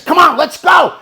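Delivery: high, loud rallying call; retold panicky urging; urgent shout. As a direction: shout to get him moving now. Friend urges frantic search.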